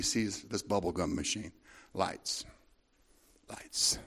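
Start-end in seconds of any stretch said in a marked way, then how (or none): 1.9-2.4 hushed ominous. Shown as a demonstration. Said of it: "Lights that you don't like seeing". 3.5-4.0 whispered, slow and ominous; soft near-whisper. Shown as a demonstration. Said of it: "Lights that you don't like seeing".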